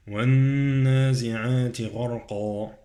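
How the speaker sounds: recited, with the doubled nun of "والنازعات" hummed; ghunnah on the nun. Shown as a demonstration. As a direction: hold a long nasal nun in "والنازعات". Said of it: "عندي غنة فوق النون في النازعات".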